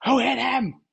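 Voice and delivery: high, loud enraged yell; mock rage, shouted high and loud; furious shout. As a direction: demand it at the top of your voice. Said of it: "Just freaking out".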